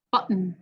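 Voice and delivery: "button" with a throat stop instead of the T; American glottal T. Shown as a demonstration. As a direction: swallow the T. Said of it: "then with a glottal".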